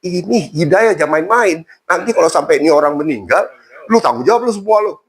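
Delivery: imitated indignant dressing-down; mild retold scolding. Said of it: "Diomelin mereka semua".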